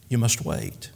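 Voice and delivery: hushed, low gentle answer; quiet, patient master; soft and quiet. Acted. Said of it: "Then softly and quietly".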